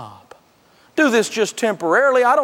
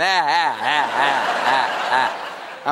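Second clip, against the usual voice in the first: lower, slower, sneering laugh; mocking laugh; 6 semitones lower, slower than usual. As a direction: laugh at him from the Mercedes. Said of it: "is laughing at me going".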